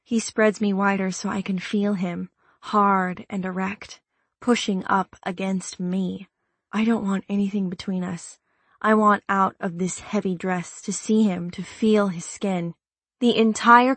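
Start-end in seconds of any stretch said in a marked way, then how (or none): none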